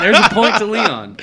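laughing. Real, not acted. Echoing point, laughing.

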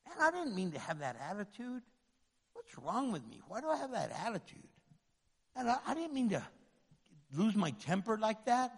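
soft, worried muttering to yourself; quiet self-talk. Retold or imitated. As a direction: hush it like a private confession. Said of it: "I'm like".

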